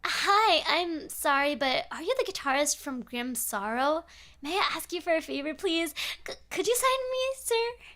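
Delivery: put-on high, eager voice of a nervous goth teen; teen fan begging the guitarist, high and eager. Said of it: "one of them approached me and said".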